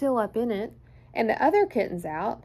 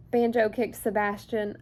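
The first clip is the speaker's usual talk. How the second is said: plain reading voice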